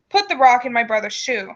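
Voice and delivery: usual speaking voice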